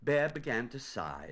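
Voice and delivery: usual speaking voice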